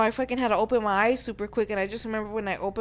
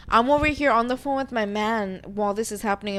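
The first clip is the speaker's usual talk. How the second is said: usual speaking voice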